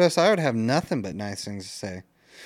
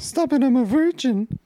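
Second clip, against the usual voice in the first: mock whine; 14 semitones higher, 5 dB louder than usual. Mimics the whiny protest.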